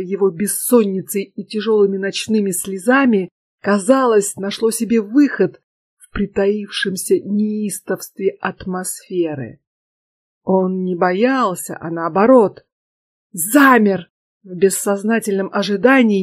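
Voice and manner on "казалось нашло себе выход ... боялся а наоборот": ordinary delivery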